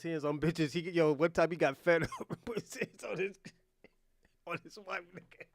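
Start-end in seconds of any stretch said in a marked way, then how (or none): 2.0-5.4 words breaking up into laughter; laughing helplessly. Real, not acted. Telling friends about a cousin, laughing helplessly. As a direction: try to tell it, collapse laughing. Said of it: "I'm not laughing at domestic violence".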